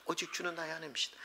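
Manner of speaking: very soft, quick repeat; softly echoed declaration of faith; hushed confession. Acted. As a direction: say it quickly under the voice. Same confession repeated quicker and softer.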